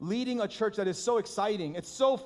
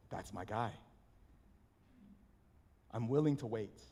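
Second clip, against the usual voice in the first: much softer and lower, gentle and fond; the Lord, tender; 14 semitones lower, 10 dB quieter than usual. Retold or imitated. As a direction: speak as a loving father who will wait. Voicing the Lord's reply.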